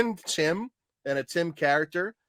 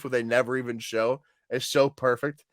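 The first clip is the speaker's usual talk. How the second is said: ordinary delivery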